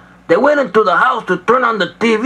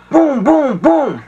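higher booming knock sound; 4 semitones higher, slower than usual. Acted. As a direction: pound out the knock harder. Knock again, louder.

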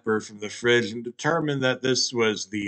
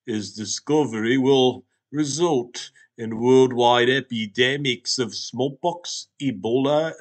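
grave talking Hot Pocket; 4 dB louder than usual. Acted. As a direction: proclaim it like an ancient oracle. Hot Pocket prophesies plagues.

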